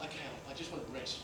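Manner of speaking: soft, quick weary plea; tired sigh. Retold or imitated. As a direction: say it worn out, almost under breath. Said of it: "Some days you wanted them to stop".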